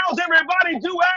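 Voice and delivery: shouted crowd greeting. Shown as a demonstration. Host asking the crowd a third time, big shout. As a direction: shout it to the back row to get them going. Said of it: "I said".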